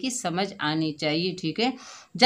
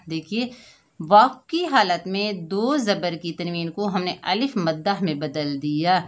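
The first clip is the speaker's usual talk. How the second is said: ordinary talk, nothing marked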